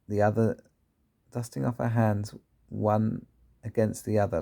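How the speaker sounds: ordinary delivery, nothing marked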